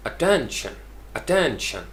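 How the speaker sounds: Attention as a-TEN-shn, falling to a light end; strong middle, small ending on attention; stress on TEN. Shown as a demonstration. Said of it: "टेन के ऊपर जो है वो stress".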